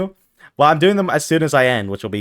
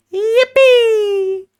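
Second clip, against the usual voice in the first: squeaky yippee; 4 dB louder than usual. Pretend. High squeal of joy.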